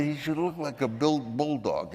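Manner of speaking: imitating Brando. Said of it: "then he said".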